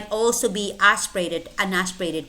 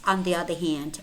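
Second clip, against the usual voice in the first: normal talking voice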